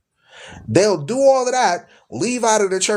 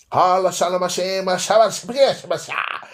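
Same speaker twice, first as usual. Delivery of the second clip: a hint of mock glossolalia chanting; lightly imitating a preacher babbling in tongues; mock tongues speaking. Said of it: "They be talking like that".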